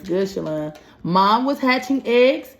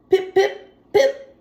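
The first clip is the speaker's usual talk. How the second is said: high squeaky pip pip pip; chick peeping; 12 semitones higher, slower than usual. Acted. Teacher voicing the hatching chick's high peeps. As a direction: peep like a tiny chick hatching.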